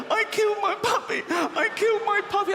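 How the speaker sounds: put-on high loud sobbing kid; wailing child voice. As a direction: wail like a guilty child.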